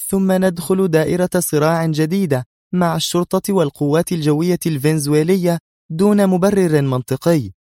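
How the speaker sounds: high-pitched, firm objection; stern. A narrator's reading. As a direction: argue it back sternly. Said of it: "قالت نادية في صرامة". (بصرامة)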